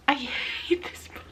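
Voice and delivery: slow, breathy whisper. Real, not acted. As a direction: confess it under your breath. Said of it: "Hate's a really strong word".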